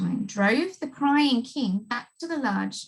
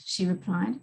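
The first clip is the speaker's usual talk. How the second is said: ordinary delivery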